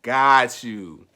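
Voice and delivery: loud, drawn-out dawning "oh"; retold realization. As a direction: act out the penny finally dropping, loudly. Retells sudden moment of realization.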